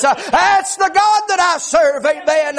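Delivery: driving, rhythmic preacher's testimony; sustained high preaching voice, phrase after phrase; full-force preaching. Acted. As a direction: pour it out in urgent revival cadence.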